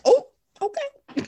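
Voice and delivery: high, slow, squeaky oh okay; mock-startled oh okay. Hearing the wedding planner's answer, squeaky mock surprise. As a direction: pretend to be caught off guard.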